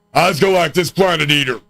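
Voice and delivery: a hint of a booming cosmic villain; lightly voiced world-devouring Galactus. Boasting he will eat the planet, light Galactus voice. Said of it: "My voice sounds like Galactus now".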